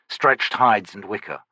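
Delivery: ordinary delivery, nothing marked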